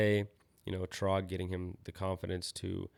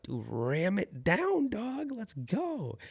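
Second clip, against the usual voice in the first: much higher, slow and stretched hype yell; hyped-up cheer; 13 semitones higher, much slower than usual. Retold or imitated. Coach hypes up the kid after his hill bomb, high stretched cheer. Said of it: "And I was like".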